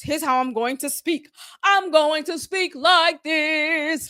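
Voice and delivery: slow, theatrical mock actor voice, rising into drawn-out sing-song; rehearsed actor. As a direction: imitate a man reciting over-rehearsed lines. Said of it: "that's how he sounds".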